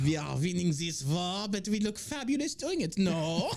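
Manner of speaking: camp German soldier; proud and camp in a German accent; mock German accent.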